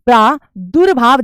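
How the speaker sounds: प्रादुर्भाव said slowly, syllable by syllable; slow careful demo. Shown as a demonstration. Teacher sounds out the word slowly for students. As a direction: draw out प्रादुर्भाव so the र before भा is heard. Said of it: "रेफ भा पर लगेगा".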